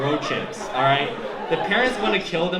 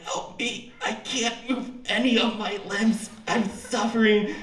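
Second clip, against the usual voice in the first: suffering teddy bear; 6 semitones higher, 3 dB quieter, slower than usual. Pretend. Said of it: "it's like".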